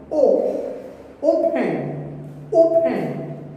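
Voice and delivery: "open" with a strong opening O; teacher demonstrating front stress on "open"; stress on first syllable. Shown as a demonstration. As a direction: hit the O of "open" and let the rest drop. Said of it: "giving stress at the beginning".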